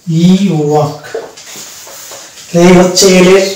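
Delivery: usual speaking voice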